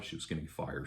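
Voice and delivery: dropped low under the breath; murmured side remark; quiet aside. Real, not acted. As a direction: slip it in as a hushed aside. Quiet aside about the manager.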